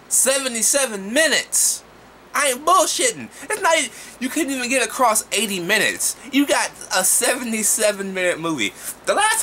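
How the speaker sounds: outraged reviewer exclaiming; incredulous outburst. Real, not acted. Outraged about short runtime.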